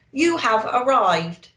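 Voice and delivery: loud flat satnav announcement; sat nav voice. A narrator's reading. The sat nav telling Santa, in a robotic voice. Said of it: "Nav said unhelpfully".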